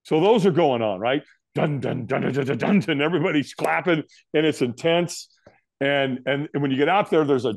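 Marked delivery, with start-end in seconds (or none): 1.5-3.0 imitating drums. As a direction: tap out the drums with your voice. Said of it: "he's got African drummers".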